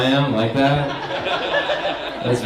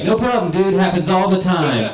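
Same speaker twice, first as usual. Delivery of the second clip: higher-pitched, breezy reply; instant easygoing reassurance; 7 semitones higher, 3 dB louder than usual. Retold or imitated. Sandwich guy to embarrassed customer, breezy instant reassurance. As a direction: toss it back without skipping a beat. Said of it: "without skipping a beat".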